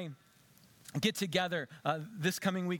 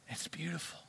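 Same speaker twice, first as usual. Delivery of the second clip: breathy hushed whisper of awe; 8 dB quieter than usual. Shown as a demonstration. Pastor to congregation, whispering in awe. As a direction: whisper it like a secret wonder.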